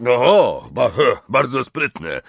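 loud, stammering sarcastic growl; gruff giant's irritated retort; growled snarl. A narrator's reading. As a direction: growl it, stumbling on the first word. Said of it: "warknął Hagrid".

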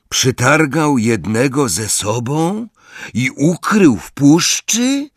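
high, slow, incredulous; can't believe what he hears; disbelief. Acted. Ron stunned by news of the giant, incredulous. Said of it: "całkowitemu niedowierzaniu".

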